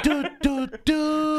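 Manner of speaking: high toy gibberish; Furby babble. Retold or imitated. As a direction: chirp nonsense like a Furby.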